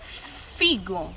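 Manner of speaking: "figo" with a long breath of f first; stretched initial sound. Shown as a demonstration. Teacher matching first sounds for children, "figo" with held f. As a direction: hold the f of "figo" before the vowel. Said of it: "pelo mesmo som de".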